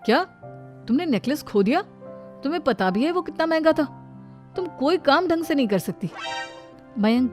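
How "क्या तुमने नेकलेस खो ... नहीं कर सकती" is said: mild angry scolding, voice raised; angry brother. Acted. Brother learns sister lost the costly necklace, mildly angry. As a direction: scold her with a touch of anger. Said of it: "गुस्से में".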